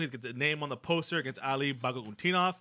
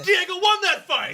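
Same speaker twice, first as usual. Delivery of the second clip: laughing outburst; 11 dB louder, slower than usual. Real, not acted. Fan insists Diego won.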